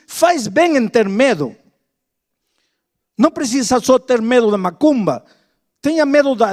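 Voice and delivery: loud, driving pulpit delivery; full-force preaching. Acted. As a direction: preach it hard to the congregation.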